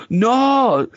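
high, drawn-out mock protest; offended Scotsman. Retelling a Scot's answer to being called British, mock outrage. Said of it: "I asked him if he was British".

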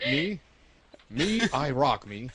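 mock self-praise. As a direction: gloat to yourself playfully.

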